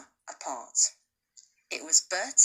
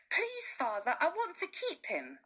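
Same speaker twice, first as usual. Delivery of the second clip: put-on higher boyish plea with a laugh; pleading boy; 9 semitones higher than usual. Bertie begs his father to keep the cub, pleading. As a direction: beg father sweetly. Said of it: "Bertie said".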